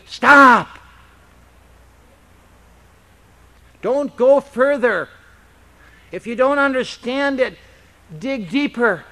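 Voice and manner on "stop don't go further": loud, firm divine command; commanding stop. Retold or imitated. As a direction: halt the listener with authority. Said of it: "the Lord is telling you".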